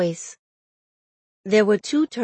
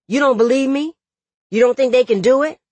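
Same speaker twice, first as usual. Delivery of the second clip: loud, high challenging questions; hurried, heated challenge; upset disbelief; 6 semitones higher, 6 dB louder, faster than usual. A narrator's reading. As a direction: push the questions upward, agitated. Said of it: "never seen him so upset before".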